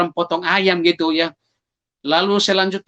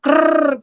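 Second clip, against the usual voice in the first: high rolled rattling noise; imitated wound rattle; 8 semitones higher, 3 dB louder than usual. Injured man breathing through his neck wound, imitated rattle. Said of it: "bernapas juga keluar".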